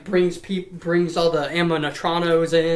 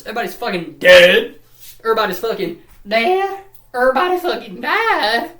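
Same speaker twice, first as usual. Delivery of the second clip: loud, rising theatrical yell; hammed-up outcry; 5 semitones higher, 7 dB louder than usual. Real, not acted. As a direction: shout it, climbing higher each time. Hammed-up climax, everyone dead.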